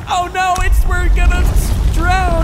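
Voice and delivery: high, loud drowning panic; panicked cry. Pretend. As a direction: shriek like you're going under.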